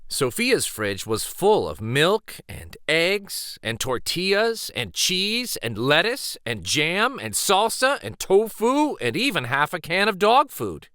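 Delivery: ordinary delivery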